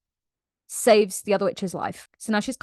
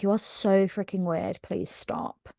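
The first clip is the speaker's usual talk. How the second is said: exasperated murmur fading to whisper; 7 dB quieter than usual. Retold or imitated. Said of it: "Manon is like".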